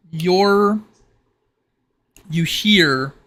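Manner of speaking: ordinary talk, nothing marked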